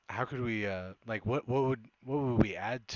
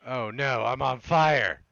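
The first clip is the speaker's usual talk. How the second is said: excited shout; 4 semitones higher, 11 dB louder, slower than usual. Real, not acted. Character catches fire in game.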